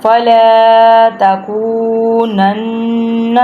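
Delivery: slow recitation, "تَكُونَنَّ" held with a nasal double n; nasal ghunna. Shown as a demonstration. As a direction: hum the doubled n of "تَكُونَنَّ" through the nose. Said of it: "ناک میں سے آواز نکالنی ہے". ("تَكُونَنَّ" کے نون پر ناک سے غنہ)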